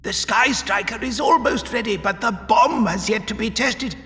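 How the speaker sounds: very high, anxious pleading voice; desperate explaining. A narrator's reading. Magric tries to explain the delay to Vorus, desperate explaining. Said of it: "Desperately, Magric tried to explain".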